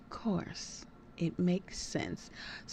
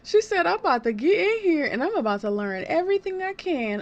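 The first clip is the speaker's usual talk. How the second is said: loud, high, giddy retelling; thrilled by her boldness, pitch it up; gleeful; 7 semitones higher, 7 dB louder than usual. Real, not acted. Gleeful reaction to character line.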